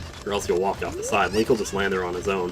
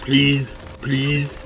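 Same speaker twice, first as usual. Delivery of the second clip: pleading; 6 semitones higher than usual. Real, not acted. Pleading with chicken.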